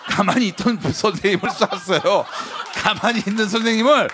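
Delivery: words squeezed through laughter; laughing while talking. Real, not acted. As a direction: keep laughing as you tell it. Telling punchline while laughing.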